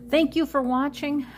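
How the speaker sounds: perky high goodbye; chirpy sign-off. Real, not acted. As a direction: sing out the thank you to viewers. Cheerful vlog sign-off thanks.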